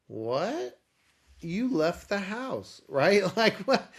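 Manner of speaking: amazed disbelief. Retold or imitated. Shock wife left house.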